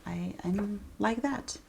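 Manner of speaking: hushed confession, breath only; whispered aside. Real, not acted. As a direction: whisper it like a secret.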